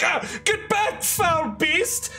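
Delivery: high, strained defensive cry; panicked farmer. Acted. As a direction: fend off a monster in a panic. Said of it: "these people are just like".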